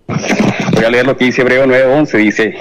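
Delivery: ordinary talk, nothing marked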